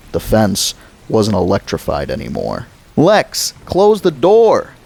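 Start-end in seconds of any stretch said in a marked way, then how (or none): 2.9-4.7 put-on high, loud, slow boy's shout; urgent shout. Boy shouts at his screaming sister to shut the door, loud. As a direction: yell it at your sister.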